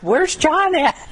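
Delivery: high, startled cry of panic; a panicked high squeak asking where John is; squeaky panicked question. Retold or imitated. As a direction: squeal it out, lost and flustered. Panicked search for the book.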